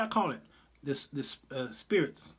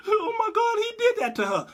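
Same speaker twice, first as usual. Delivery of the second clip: high, laughing mock dismay; mock shocked gasp; 14 semitones higher than usual. Mimicking the public reacting to her testimony, high laughing shock. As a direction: gasp it out like a stunned crowd, half laughing. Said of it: "America was like".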